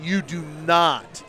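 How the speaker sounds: slow, emphatic warning; defense guys. Retold or imitated. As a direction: lay down the law slowly. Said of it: "defense guys are going".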